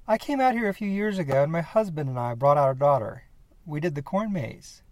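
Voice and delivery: high, bright rush that slows at the end; nervous eagerness. Acted. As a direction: explain yourself a little anxiously.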